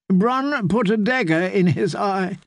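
a battered wife stuttering through tears; stammering distraught lady. Acted. Felice continues, shaken.

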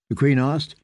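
ordinary delivery, nothing marked

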